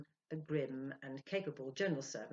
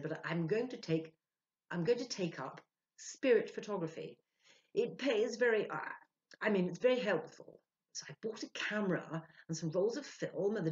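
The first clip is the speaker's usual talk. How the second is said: lightly voiced man, eager and a bit louder; Ludwig, keen; 5 semitones higher, 7 dB louder than usual. Ludwig eagerly tells Sylvia about spirit photography, lightly voiced. Ludwig explains his plan.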